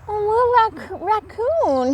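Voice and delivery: high tender big brother; caring brother. A narrator's reading. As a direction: soothe your little brother.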